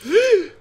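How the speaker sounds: sharp high gasp; a sudden startled intake of breath; shocked gasp. Real, not acted. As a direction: gasp in disbelief. Shock at something on screen.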